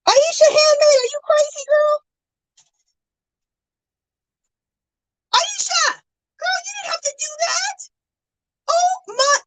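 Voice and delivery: very high disbelieving squeal; stunned squeal. Real, not acted. Streamer to viewer after a big gift, stunned squeal. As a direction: squeal it way up high in real shock.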